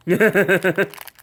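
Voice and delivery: nervous laugh. Real, not acted. Dreading the sour gum.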